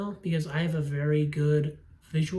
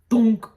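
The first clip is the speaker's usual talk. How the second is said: a sudden loud, higher "thunk"; loud thunk sound; 5 semitones higher, 10 dB louder than usual. Retold or imitated. Reviewer mimicking the film's final impact, loud and sudden.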